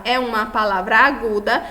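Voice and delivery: ordinary talk, nothing marked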